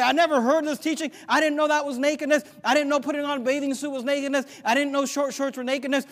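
lightly acted protester, pitched high; mock objector. Acts out an objecting listener.

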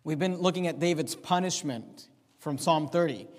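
ordinary delivery

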